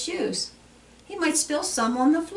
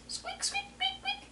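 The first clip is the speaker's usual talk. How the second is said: tiny, very high, hushed squeaks; mouse squeaking; 11 dB quieter than usual. Retold or imitated. Voices the mouse in picture book.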